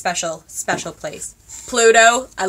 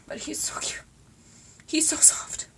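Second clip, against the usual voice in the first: voiceless, overcome whisper; tearful whisper; 8 semitones higher, 8 dB quieter than usual. Real, not acted. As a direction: whisper it, moved to tears by cuteness. Tearful, adoring the stuffed animal.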